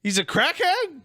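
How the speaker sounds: high shocked question; can't believe it; surprised. Real, not acted. Shocked by news.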